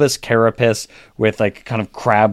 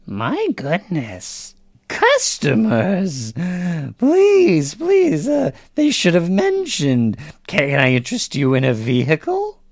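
high, wavering pitch, slow start; eager old hag saleswoman; 8 semitones higher than usual. Acted. Hag merchant spotting customers and offering a vehicle, high eager voice. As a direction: greet customers in a high crone voice, flustered.

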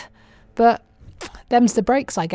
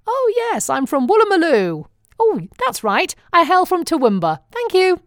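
higher, exaggerated Australian accent, pretending to be local; mock Australian; 8 semitones higher than usual. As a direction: play a cheeky fake Australian naming her town. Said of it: "give the name of random Australian towns".

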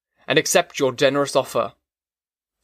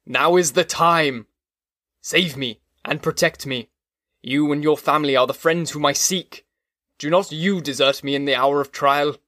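usual speaking voice

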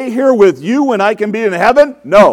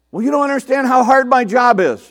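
higher-pitched mock grumbler's protest; mock complaining; 6 semitones higher than usual. Preacher mimics a complainer moaning about a hard job, mock complaining. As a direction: imitate someone making excuses about their lot.

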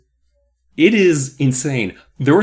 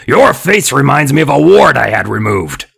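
loud, nasty growl, pitched up; growling dummy; 7 dB louder than usual. A narrator's reading. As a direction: growl the insult like an evil dummy. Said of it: "Mister Wood growled at the startled woman".

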